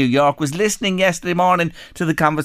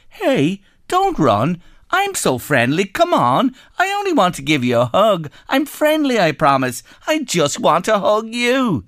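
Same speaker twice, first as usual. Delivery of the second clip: higher, sing-song fake friendliness; mock friendly pleading; 7 semitones higher than usual. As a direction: chase her with a hammed-up sweet voice. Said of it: "biggest, friendliest smile".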